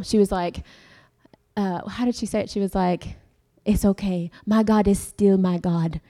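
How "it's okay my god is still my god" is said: put-on emphatic quote in a Jamaican accent. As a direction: imitate a believing Jamaican mother's accent. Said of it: "do it in a Jamaican accent".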